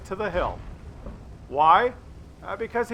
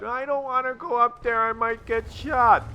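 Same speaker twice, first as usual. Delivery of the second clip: mock scared whine; 5 semitones higher than usual. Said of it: "scared, sniveling little worms".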